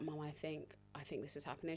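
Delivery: low, soft, tentative murmur; hushed confiding. Retold or imitated. Telling mum labour is starting, hushed. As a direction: wake her quietly with uncertain news.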